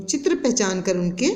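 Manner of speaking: usual speaking voice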